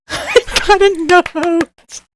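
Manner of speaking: high, slow, drawn-out gloating; giddy excitement. Real, not acted. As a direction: stretch it out with giggly delight. Drags out the discovery, giggling.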